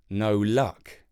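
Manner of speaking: ordinary delivery, nothing marked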